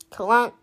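a heavy metal clunk, voiced like the car; imitated engine clunk. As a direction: make the broken engine go clunk. Car noise imitated in story.

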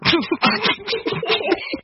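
breathy high giggle at a slip; embarrassed giggle. Real, not acted. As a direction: laugh off the mistake.

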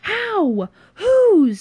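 very high, loud shouted questions; screamed challenge. Acted. Friend screams back in the quarrel, incredulous. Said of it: "screamed Dave".

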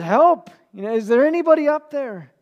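high, loud desperate yell; panicked cry for help. Retold or imitated. Falling climber calling up for help, high loud yell. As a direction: shout up the cliff, clinging on. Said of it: "he just said".

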